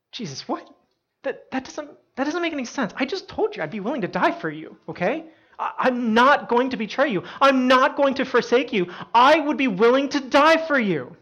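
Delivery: high, stammering protest building to loud insistence; indignant disbelief. Retold or imitated. Peter rejecting Jesus's prediction, indignant and insistent. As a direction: act out being baffled, then insist with rising force. Peter disputes being told he'll deny.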